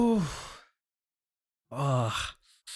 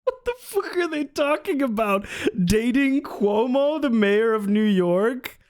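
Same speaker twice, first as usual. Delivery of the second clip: baffled disbelief; 9 semitones higher than usual. Real, not acted. Streamer baffled by their talk, incredulous disbelief. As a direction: spell out how absurd it is.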